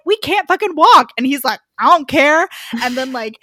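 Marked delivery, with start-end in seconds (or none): 0.1-1.1 high, loud, incredulous complaint; mock outraged protest. Kneecapped thugs told to leave, mock outraged yell. 1.8-2.5 high, loud, mock-tough retort; loud dismissal. Gunman brushing off crippled thugs, loud dismissal.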